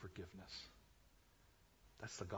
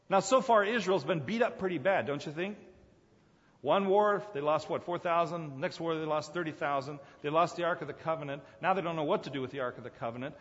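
louder, driving sermon storytelling; preacher recounting Israel's defeats, louder; preaching; 4 semitones higher, 8 dB louder than usual. Real, not acted. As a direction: push the story hard from the pulpit.

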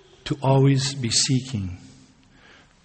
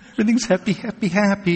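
higher, bubbly mimic of cheery people; mock bubbly happy; 8 semitones higher, faster than usual. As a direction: play the always-cheerful type, a bit mocking.

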